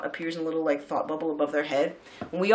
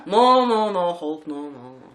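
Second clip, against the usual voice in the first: put-on pure Derry accent; 6 dB louder, slower than usual. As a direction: put on a broad Derry accent, fading as you go. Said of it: "like, a pure Derry accent".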